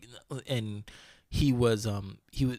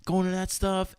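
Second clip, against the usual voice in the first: high-pitched mocking rant as the girlfriend; mocking angry girlfriend; 9 semitones higher than usual. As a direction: mimic her ranting at him, pitched well up. Girlfriend's rant voiced in story.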